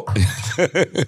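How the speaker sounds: short chuckle after a teasing remark; amused chuckle. Real, not acted. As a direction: let out a light laugh. Laughs after joking about tears. (ketawa kecil)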